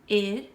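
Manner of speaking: "id" said loudly and on its own; exaggerated word endings. Shown as a demonstration. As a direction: hit "id" hard. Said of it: "which word ending says id".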